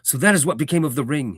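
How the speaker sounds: a man exclaims at the news; crying out. A narrator's reading. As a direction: call it out in surprise. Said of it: "he cried".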